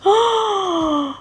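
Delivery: high sharp gasp of delight. Real, not acted. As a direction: gasp at the first bite. Tastes the squash.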